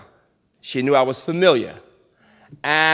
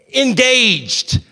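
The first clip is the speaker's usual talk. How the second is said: ordinary delivery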